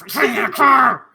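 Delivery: loud, high protest; insistent shout. Pretend. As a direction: yell it stubbornly. Player insists against the others' refusal.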